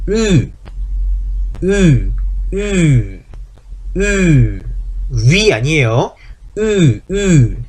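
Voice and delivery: rounded lips frozen, say "으" as German ü; German ü in "으", lips stay in a u shape; umlaut ü. Shown as a demonstration. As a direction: hold "으" with lips rounded as for u, only the tongue moving to i. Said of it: "위가 아니고 어떻게 된다".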